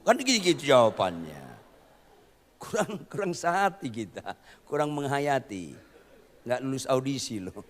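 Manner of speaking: normal talking voice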